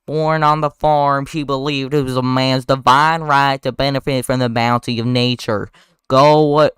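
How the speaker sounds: loud, slow, heavy southern drawl; hammed-up southern courtroom lawyer; exaggerated southern drawl. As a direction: orate to a jury in broad Southern. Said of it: "speaks in a very heavy and exaggerated southern drawl".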